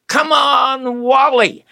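high, stretched-out cheer from the stands; re-enacted fan holler. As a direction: call out high to a favourite player. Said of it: "always hollered".

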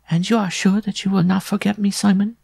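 very high-pitched bride voice; high, pleading sweetheart; bride's secret question. A narrator's reading. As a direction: ask your lover in a high, put-on female voice. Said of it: "she whispered".

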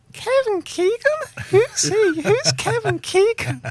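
loud, high American voices; American accent. Retold or imitated. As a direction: imitate baffled Americans. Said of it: "all the Americans were going".

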